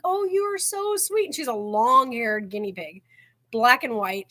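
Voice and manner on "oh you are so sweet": cooing at pet. Retold or imitated. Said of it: "she was like".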